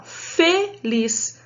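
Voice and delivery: "feliz" very slowly, broken as fe-liz; slow teacher's syllable split of "feliz". Shown as a demonstration. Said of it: "Olha a sílaba lá".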